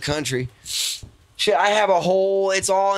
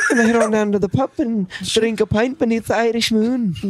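lilting, higher-pitched Irish accent; 5 semitones higher than usual. Shown as a demonstration. Showing friends his Irish accent at the table, Irish accent. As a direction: put on a confident Irish brogue. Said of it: "He can do it real good".

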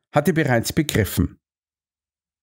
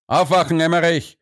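calling out; 7 semitones higher than usual. A narrator's reading. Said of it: "rief er".

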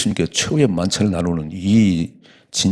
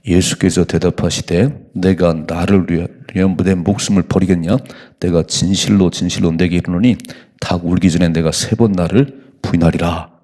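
slow, louder solemn reading; solemn scripture reading; 2 semitones lower, 4 dB louder than usual. Shown as a demonstration. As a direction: read the verse with weight. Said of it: "읽어봐요". (천천히 장엄하게 성경 낭독)

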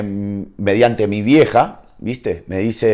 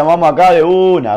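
higher, louder call to come along; rally a mate, re-enacted with energy; eager retold invitation; 7 semitones higher, 6 dB louder than usual. Older friend calling him to come right now, eager and loud.

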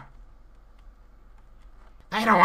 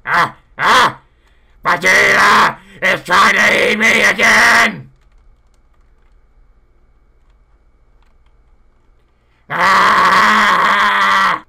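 loud, higher, frantic yelling; panicked scream; 7 semitones higher, 8 dB louder, slower than usual. Acted. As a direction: scream like you're being chased. Panicked, fleeing Vegeta.

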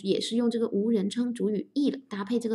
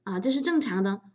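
usual speaking voice

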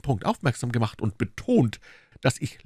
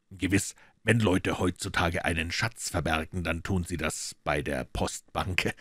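lower, calm, mocking Holmes; cool detective teasing the maid; dry detective; 4 semitones lower than usual. Holmes coolly needling the maid, lower voice.

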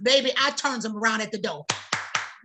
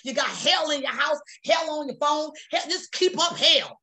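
ordinary talk

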